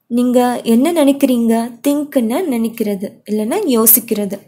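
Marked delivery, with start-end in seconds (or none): none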